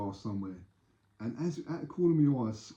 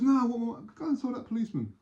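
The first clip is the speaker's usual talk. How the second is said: high-pitched mock indignation and taunt; acted quarrel; 12 semitones higher than usual. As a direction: argue it out in a raised story voice.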